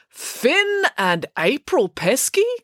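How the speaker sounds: high, slow, questioning read-out; puzzled announcement. Acted. Official reads unexpected owners off his notes, slow and puzzled. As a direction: stretch the names out in disbelief.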